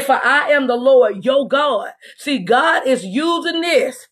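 ordinary talk, nothing marked

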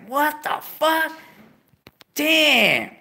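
high, slow, stunned; shocked. Retold or imitated. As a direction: let the shock sink in slowly. Shocked reaction.

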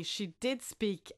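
plain reading voice

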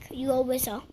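ordinary delivery

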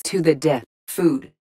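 usual speaking voice

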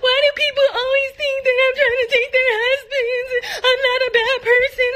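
high, whiny mock wailing; mock crying. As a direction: imitate someone bawling in self-pity. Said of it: "You were crying, talking about".

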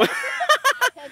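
short high peal of laughter; laughing. Real, not acted. The player's turn is done, high laughter. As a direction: burst out laughing, high-pitched.

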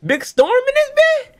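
slow, very high, loud fan scream; screaming excitement. Real, not acted. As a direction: shriek the hero's arrival in disbelief. Screaming as favourite character appears.

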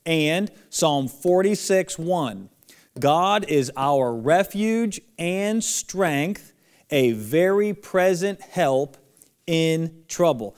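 slow and loud, every word drawn out; teacher calling out a memory verse for kids to repeat; slow lead-along recitation. Shown as a demonstration. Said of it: "Say it with me".

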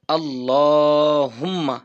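আল্লাহুম্মা chanted slowly, the long vowel stretched and held; slow drawn-out recitation. Shown as a demonstration. Teacher chanting the dua for students, slow and drawn. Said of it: "দেখেন".

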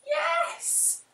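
high, bright cry of yes; eager high yes; cried yes. A narrator's reading. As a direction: welcome him aboard gladly. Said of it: "cried the witch".

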